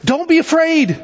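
high-pitched call to frightened men; crying out. Retold or imitated. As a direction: cry it out high over the storm. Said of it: "Jesus cries out to him".

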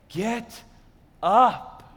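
loving father. Acted. Loving father leaning down to his son, gentle. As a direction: say it tenderly, a pause between the words. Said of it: "is from a loving father".

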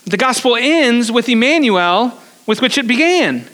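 normal talking voice

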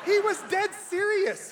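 amused disbelief spilling into laughter; laughing. Real, not acted. Laughing at his father's revenge.

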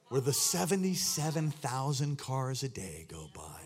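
normal talking voice

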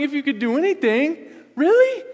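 really? jumping very high and loud; mock astonishment from Jesus; Jesus scoffing at the father's doubt; incredulous Jesus. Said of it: "and Jesus responding".

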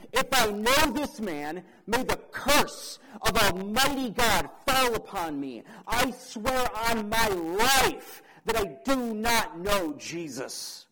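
loud, high, vehement oath; Peter invoking a curse. Acted. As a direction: call the curse down on yourself at full voice. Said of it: "This curse, this statement might have been something like".

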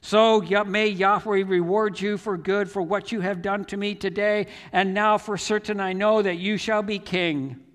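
loud, high proclamation; shouting back. Retold or imitated. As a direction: proclaim a blessing across a valley. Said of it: "he shouted back".

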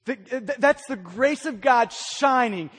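usual speaking voice

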